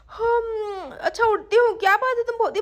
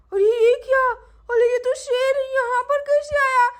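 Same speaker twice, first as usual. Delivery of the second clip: startled; 3 semitones higher, 5 dB louder than usual. Acted. Wakes to find lion.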